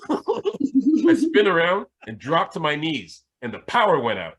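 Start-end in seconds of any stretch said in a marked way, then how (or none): 0.1-3.2 slow, laughing through the words; can't hold back the laugh. Real, not acted. Laughing while telling dance move.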